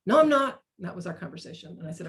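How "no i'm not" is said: much higher and louder, indignant; defensive protest. Retold or imitated. Teen argument with speaker.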